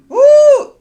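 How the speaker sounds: sudden high loud whoop. Real, not acted. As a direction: let out a big excited woo. Cheering after dark news joke.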